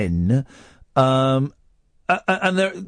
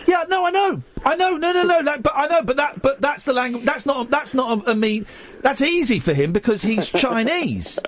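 heated protest; 7 semitones higher than usual. Real, not acted. Host protesting over the caller.

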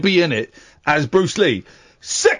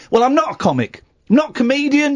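indignant; 9 semitones higher than usual. Acted. Indignant correction.

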